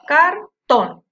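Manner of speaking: "Cartón" loud, in two separate syllables, "car" and "tón"; syllable stress test. Shown as a demonstration. Teacher asking which syllable is stronger, "Cartón" split and loud. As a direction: sound out "Cartón" forcefully, syllable by syllable. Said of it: "Cuál suena más fuerte de las sílabas".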